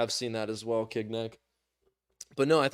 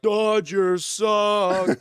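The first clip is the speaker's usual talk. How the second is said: very slow, louder drawn-out jeer; mock jeer; 7 semitones higher, 7 dB louder, much slower than usual. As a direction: stretch every sound like a clueless fan. Fan in Dodger gear booing.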